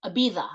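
Ibiza again with the lisped th; Spanish lisp. Shown as a demonstration. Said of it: "you say it like that with a lisp".

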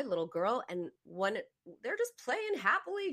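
ordinary delivery

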